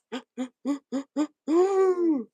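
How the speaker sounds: high wheezing gasp of a laugh; hammed-up high gasping laugh; Jimmy Carr laugh. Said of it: "that's how Jimmy Carr laughs".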